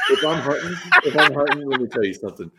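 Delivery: turned-up Southern accent. Shown as a demonstration. Mississippi man showing how he talks when hunting, Southern accent. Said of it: "Do you turn it on".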